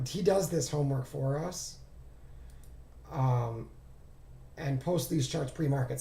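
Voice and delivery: ordinary delivery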